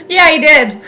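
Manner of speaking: high bursts of laughter round the words; delighted chuckle; laughing yes. Real, not acted. Laughing at the play.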